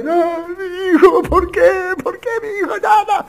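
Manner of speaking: very high, slow wailing lament; imitated anguished why-my-son wail; despairing parent. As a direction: cry out like a desperate parent, stretched and high. Despairing parents whose son died.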